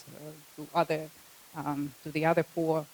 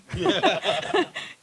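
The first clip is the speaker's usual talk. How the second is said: short high burst of real laughter; 12 semitones higher, 9 dB louder than usual. Real, not acted. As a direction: laugh out loud at your own joke. Laughs at own football remark.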